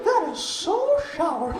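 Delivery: high, slow squeaky cartoon mouse; Mickey Mouse voice. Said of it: "I used to do the voices".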